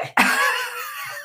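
very high-pitched giggling fit; real squeal of laughter, high up. Real, not acted. Guest cracking up when asked how she did it, high laugh.

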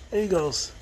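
ordinary talk, nothing marked

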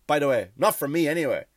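ordinary talk

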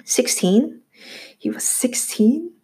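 put-on a boy learns his own age, stunned; stunned disbelief. A narrator's reading. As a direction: turn it over in disbelief. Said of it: "so stunned he barely heard".

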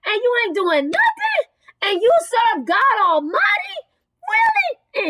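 high-pitched, incredulous preacher's rebuke; indignant preaching. Acted. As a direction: scold them in disbelief, climbing into a squeal.

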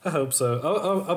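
usual speaking voice

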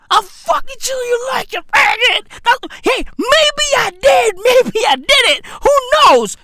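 squealed, giddy mock protest; very high, hammed-up comic voice; high-pitched hamming. Hammed-up repeat and coy denial.

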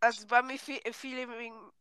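ordinary delivery

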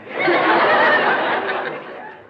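a high, airy cackle at his own joke; high breathy cackle. Acted. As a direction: wheeze out a laugh at the punchline. Professor laughs at own joke.

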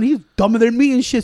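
usual speaking voice

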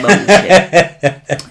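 bullshit through a loud laugh. Real, not acted. Repeats the word while laughing.

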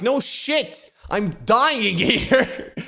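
high-pitched, drawn-out incredulous retort; hammed-up panicked indignation, words stretched out; exasperated inner outburst. Patient silently ranting at the nurse in his head, exasperated. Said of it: "I don't say it, but in my head".

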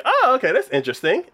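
high-pitched imitation of polite interest; mock casual interest. Boy answering her eraser myth, acted casual interest.

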